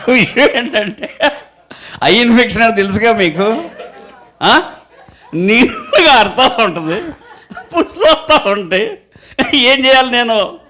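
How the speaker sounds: amused giggling, pitch pushed up; laughing. Real, not acted. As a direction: laugh while explaining the eye infection. Pastor laughs at his story.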